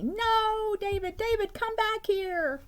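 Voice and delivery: high, slow urgent mother calling; urgent mom. Acted. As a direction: call him back with urgency. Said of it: "saying this with great urgency".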